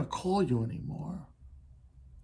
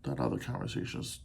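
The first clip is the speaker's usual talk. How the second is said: whispered aside; 12 semitones lower, 10 dB quieter, faster than usual. Real, not acted. Aside on a tough topic.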